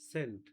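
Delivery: সেন্ট set apart, ending in "t"; careful pronunciation. Shown as a demonstration. Teacher repeating the past of send, careful pronunciation. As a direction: model সেন্ট with a final t, not d. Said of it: "পাস্টের উচ্চারণটা হবে".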